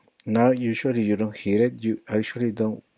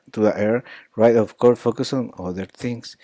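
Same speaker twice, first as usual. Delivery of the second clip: usual speaking voice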